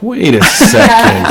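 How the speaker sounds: high, slow, startled wait; sudden realization. Retold or imitated. Dreamer realizing the math, high startled slowdown. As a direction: catch yourself mid-dream in disbelief. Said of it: "took me out of my dream".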